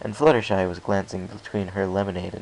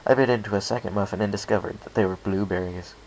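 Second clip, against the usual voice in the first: usual speaking voice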